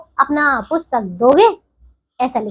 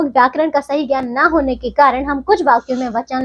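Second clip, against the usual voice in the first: normal talking voice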